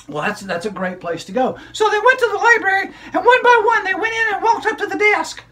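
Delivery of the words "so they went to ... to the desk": put-on high, bright child puppet storyteller; squeaky kid character excitedly telling a story; high-pitched puppet girl narrating.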